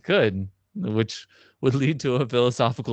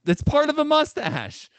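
incredulous. Real, not acted. Teasing argument about mustache.

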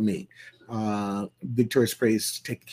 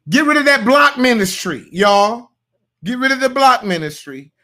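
much higher and louder, preaching it; loud exhortation; 11 semitones higher, 10 dB louder than usual. Real, not acted. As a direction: call it out to the whole congregation. Preacher exhorts his audience loudly.

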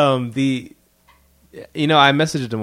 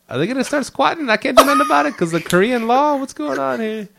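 high-pitched mock alarm; hammed-up fretting in a high voice; mock panic; 13 semitones higher, 4 dB louder than usual. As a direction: act out a worried thought, voice shooting up. Retold panicked thought about squatters.

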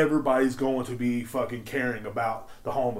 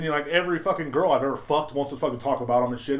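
ordinary talk, nothing marked

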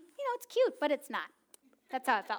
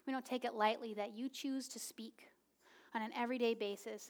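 whispered prayer; 8 dB quieter than usual. Real, not acted.

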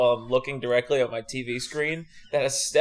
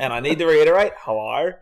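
drawled-vowel Australian hello, rising at the end; Australian accent; 5 dB louder than usual. Pretend. Co-host reiterating his hello to listeners, Australian accent. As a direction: greet with put-on Australian vowels. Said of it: "in an Australian accent".

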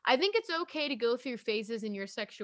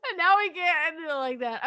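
ordinary talk, nothing marked

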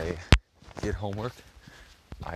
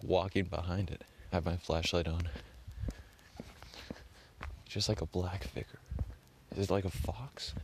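ordinary talk, nothing marked

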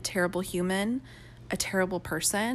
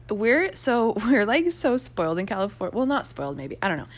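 usual speaking voice

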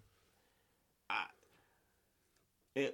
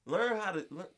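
ordinary talk

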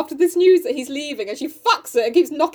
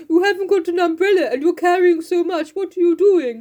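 higher-pitched comic character voice; Mister Bear; 5 semitones higher, 3 dB louder than usual. Acted. Bear questioning a rain-soaked Jo, put-on voice. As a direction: play the fussing suitor, pitched up. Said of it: "He's like".

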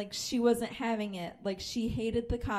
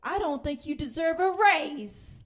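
mocking the boss; 5 semitones higher, 4 dB louder than usual. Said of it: "she was like".

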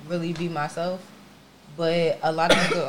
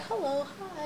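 greeter voice; 8 semitones higher, 14 dB quieter, much slower than usual. Pretend. Mimicking a greeter at work.

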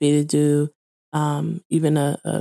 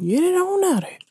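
high playful send-off; 14 semitones higher, faster than usual. Acted.

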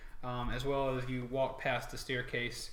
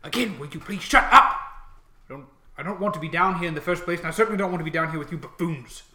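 loud, raised, hurried scolding; irritated orc mage; 4 semitones higher, 10 dB louder, faster than usual. Acted. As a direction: tell off two fools as a fed-up mage. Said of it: "the mage one says".

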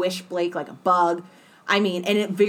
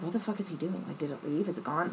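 much softer, lower aside; hushed bewilderment; 3 semitones lower, 12 dB quieter, faster than usual. Retold or imitated. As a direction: wonder under your breath, retelling it. Retelling her hushed confusion at the scene.

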